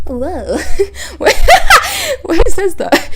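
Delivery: laughing, high and loud. Real, not acted. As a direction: squeal it through laughter. Bursting into laughter at text.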